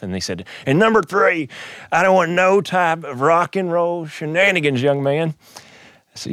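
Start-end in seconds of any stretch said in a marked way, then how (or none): 0.6-5.3 slow, high-pitched mock sternness; gruff board chair. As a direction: lay down the rule like a gruff old official. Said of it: "this big, gruff, six-foot-six gentleman".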